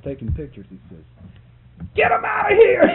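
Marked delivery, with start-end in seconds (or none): 1.9-2.9 full-throated yell, much higher and louder; yelled order. Retold or imitated. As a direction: yell it so it echoes through the bank. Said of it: "He yelled".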